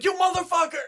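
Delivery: very high, loud imitated outburst; mock furious shout. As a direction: blow up at the boyfriend, hammed-up. Acting out Mila Kunis exploding at boyfriend.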